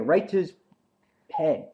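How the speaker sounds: ordinary talk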